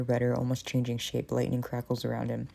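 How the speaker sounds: ordinary talk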